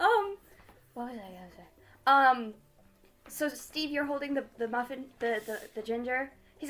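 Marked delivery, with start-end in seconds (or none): none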